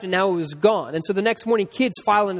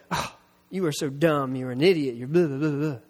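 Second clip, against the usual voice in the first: low, muttered self-reproach, lightly acted; self-scolding; 4 semitones lower than usual. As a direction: imitate someone berating himself, pitched down. Scolding himself after a mistake.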